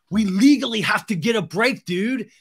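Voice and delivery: high, loud bro complaint; mock-outraged whine; indignant demand. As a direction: insist like a fed-up bro. Acted Tom demanding a break.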